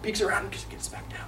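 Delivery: near-whispered narration of a stealthy move; hushed sneaking. A narrator's reading. Storyteller follows boy sneaking by Dad's desk, hushed. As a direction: drop to a whisper as if hiding.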